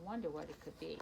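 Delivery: soft, low wondering aside; hushed musing. Real, not acted. Reader wondering what is under the dog, hushed. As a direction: murmur curiously to yourself.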